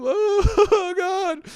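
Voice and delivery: high wailing mock sob; imitating crying. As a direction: wail it like a kid bawling over a fish. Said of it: "And then I start to cry".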